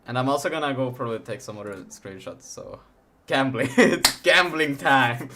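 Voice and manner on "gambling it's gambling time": loud, slow hype yell; excited hype. Real, not acted. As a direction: announce it with big hype.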